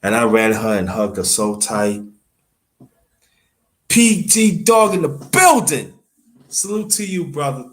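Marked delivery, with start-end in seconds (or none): 3.9-7.7 real stream shout-out; hype shout-out. Real, not acted. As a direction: call the name out like announcing a guest. Greeting a viewer on stream.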